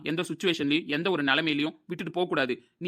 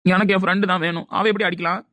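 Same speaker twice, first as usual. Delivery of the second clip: loud, indignant retort; outraged, forceful protest; angry insistence; 3 semitones higher, 7 dB louder than usual. Acted. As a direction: insist hotly on the friend's side. Angry argument defending friend.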